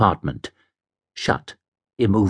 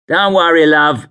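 higher-pitched, chirpy cabbie voice; 6 semitones higher, 5 dB louder than usual. Acted. Cabbie greets drunk fare, breezy. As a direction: pipe up as a London cabbie. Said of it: "said the cabbie".

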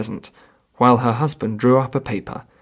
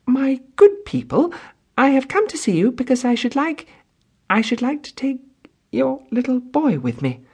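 high, halting, nervous plea; a faltering request from an anxious woman; broken, trembling voice; 13 semitones higher than usual. A narrator's reading. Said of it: "in a broken, trembling voice".

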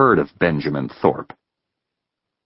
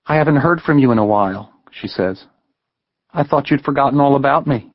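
higher voice, a touch reproachful; young woman, hurt; 8 semitones higher than usual. A narrator's reading. Heather chiding Ben for his silence, higher and reproachful. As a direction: sulk at him for not calling.

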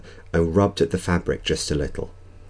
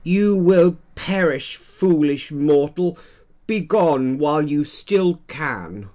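high, slow menacing vampire voice; hammy doom-laden vampire threat; dark lord; 10 semitones higher, slower than usual. Acted. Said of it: "He got into character pretty quick".

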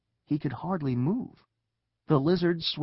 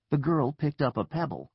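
plain reading voice

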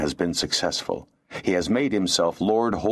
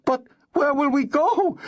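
very high, despairing woman's wail; falter, then cry out hopelessly; high-pitched, helpless plea. A narrator's reading. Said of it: "the woman cried despairingly".

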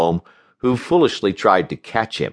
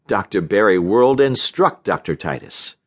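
ordinary delivery, nothing marked

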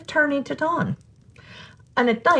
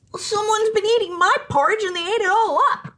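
much higher, upset little bear; crying Baby Bear; 11 semitones higher than usual. Acted. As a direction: wail it like a small cub. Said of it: "cried the Baby Bear".

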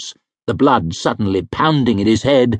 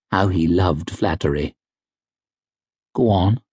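ordinary delivery, nothing marked

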